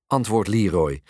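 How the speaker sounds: ordinary delivery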